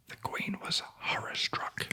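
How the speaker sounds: ordinary delivery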